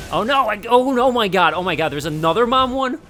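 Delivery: high, loud panicked shrieks; screaming in panic. Real, not acted. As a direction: shriek as the bat attacks. Second bat mother appears.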